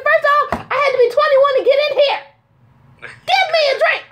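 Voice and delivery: high-pitched, mock-outraged comeback; hammed-up sassy demand, voice way up; indignant retort. Woman telling off bartender who carded her, indignant.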